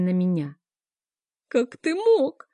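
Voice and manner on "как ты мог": higher, slow, broken words through tears; tearful babbling. A narrator's reading. Mother confronts Kerem through tears, high and slow. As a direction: plead through sobs. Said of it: "сквозь слёзы залепетала я".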